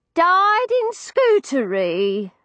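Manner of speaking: put-on high, slow old woman, puzzled; a deaf old lady, bewildered; old landlady voice.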